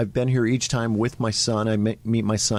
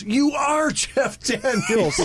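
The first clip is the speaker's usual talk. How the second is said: high, drawn-out mock disbelief with a laugh; retold astonishment; 12 semitones higher, much slower than usual. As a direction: imitate an amazed fan, stretching it out high. Retold guy's astonished reply in story.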